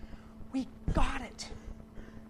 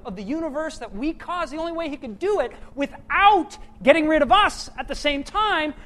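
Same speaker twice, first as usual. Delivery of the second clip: loud, building sermon climax; preaching; 6 semitones higher, 11 dB louder than usual. Acted. Pastor to church, preaching at full force. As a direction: drive the point home to the congregation.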